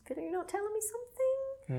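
high, soft sing-song probing; mock suspicion. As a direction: coax it out in a high, quiet voice. Acting the suspicious lieutenant.

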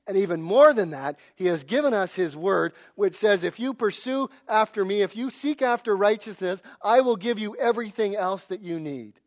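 loud, driving sermon delivery; forceful preaching. Acted. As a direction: preach it hard to the congregation. Preaching at full force again.

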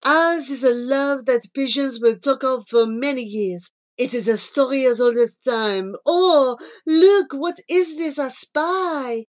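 grandiose French lover, suddenly distracted; Jean-Claude. Jean-Claude wooing Charlie until he spies something, grandly amorous. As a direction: declaim romance, then lift into excited discovery.

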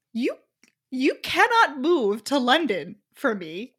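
imitating him, slow and emphatic; reasonable objection. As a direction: retell his objection slowly, pausing after "you". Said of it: "very reasonably is like".

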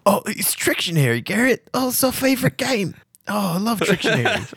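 high, gushing bound prisoner; eager captive. Acted. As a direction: gush with relief. Gazebo recalls game eagerly.

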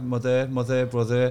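mother and brother with the final R sounded; ER endings. Shown as a demonstration. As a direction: end mother, brother on a clear R. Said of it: "the E R at the end".

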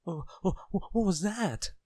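high, stuttering alarm; terrified shriek. A narrator's reading. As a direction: stammer into a high frightened shriek. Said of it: "shrieked Mister Jelly".

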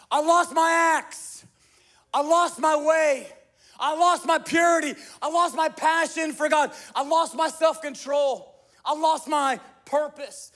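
loud, high cry of loss. Acted. The congregation voices the prophet's loss to God, loud and pleading. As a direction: shout each loss out like a desperate plea. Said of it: "He cried out".

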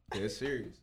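ordinary delivery

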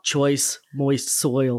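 "Choice Moist Soil" very slow, in a put-on voice; character voice. Repeating the product name, slow put-on character voice.

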